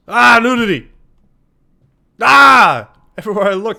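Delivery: loud, high shocked yelp; shocked shout. Real, not acted. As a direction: recoil in loud mock horror. Shocked outburst at game nudity.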